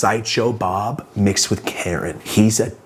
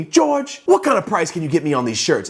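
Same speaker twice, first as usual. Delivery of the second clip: put-on high squeaky old-man voice; imitating George; 7 semitones higher than usual. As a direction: do George's voice, pitched well up. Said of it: "I hear somebody go".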